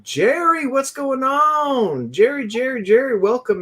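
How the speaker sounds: high-pitched, stretched-out hollering welcome; excited greeting. Real, not acted. Host greeting his buddy Jerry as he joins, excited high greeting. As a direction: sing out the friend's name with real delight. Greeting a friend who just joined.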